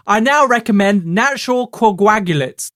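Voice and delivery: high, emphatic TV cook voice; Julia Child parody. Acted. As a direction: advise grandly in a put-on high voice. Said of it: "pretending basically to be Julia Childs".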